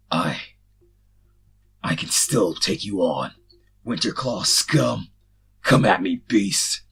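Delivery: loud, slow, defiant bellow; yelled. A narrator's reading. Wounded swordsman yelling at the charging soldiers, loud defiant bellow. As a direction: yell it out through the pain, daring them. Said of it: "the large man yelled".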